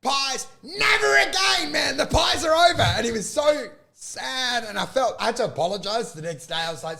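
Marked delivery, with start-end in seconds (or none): 0.0-2.8 high retold shout; heated ban. Comic to pieman after the show, exasperated high shout. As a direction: declare it over in mock outrage. Retold angry rant coming off stage.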